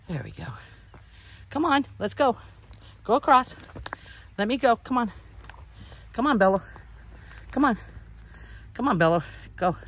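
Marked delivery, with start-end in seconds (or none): 1.5-9.8 raised calling voice, higher. Real, not acted. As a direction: call the sheep along. Calling sheep across bridge.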